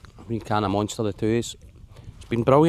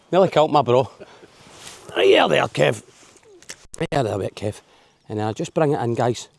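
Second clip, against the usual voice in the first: normal talking voice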